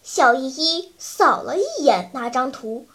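plain reading voice

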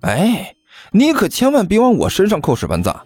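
high, mock-injured lilt; cheerfully disown the blame; smiling denial. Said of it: "甘球笑眯眯地开口说道". (笑眯眯地推脱)

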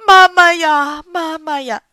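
loud wailing for mama; wailing cry. Acted. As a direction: howl out the grief. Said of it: "也大哭起来".

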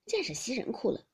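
plain reading voice

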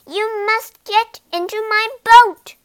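high, loud insistent mosquito; stubborn little insect repeating the rule; bossy mosquito. A narrator's reading.

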